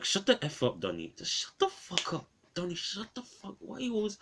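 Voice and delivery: high, strained yell held down in volume; mock angry yelling. Reviewer quoting a film character snapping at Donnie, mock strained yell. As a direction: imitate a furious telling-off in a squeezed, breaking high voice. Acting out film's yelled line at Donnie.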